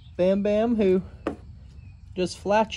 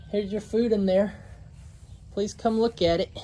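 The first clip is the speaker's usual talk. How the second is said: ordinary talk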